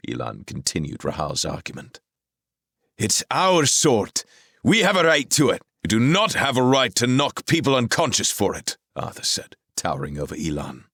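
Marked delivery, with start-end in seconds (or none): 3.0-5.6 high, loud defiant protest; defiant youth. A narrator's reading. Elan protests to Merlin, loud and high. 5.8-8.8 stern rebuke. A narrator's reading. Arthur towering.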